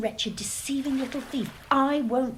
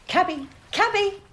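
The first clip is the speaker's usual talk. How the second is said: calling out for a cabby, rising the second time; second call higher and more urgent; 7 semitones higher, 3 dB louder than usual. Acted. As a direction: hail the cab across the street. Hailing a cab in the street.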